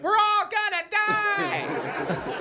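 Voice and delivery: very high, slow mock panic; hammed-up kid wailing doom; panicked daughter. Comic imitating the daughter as the canoe rocks, very high and slow. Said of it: "my daughter's like".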